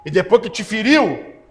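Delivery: ordinary talk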